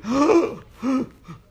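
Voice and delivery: loud, very high imitated gasp; startled high gasp; crowd gasp. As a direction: mimic a shocked crowd gasping. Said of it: "they're all going".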